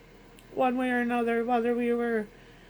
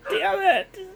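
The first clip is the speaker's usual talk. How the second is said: crying; 8 semitones higher than usual. Real, not acted. Breaking down at the end.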